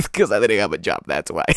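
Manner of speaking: words pushed out through laughter; laughing while talking. Real, not acted. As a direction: still chuckling as you explain. Laughing about being unemployed.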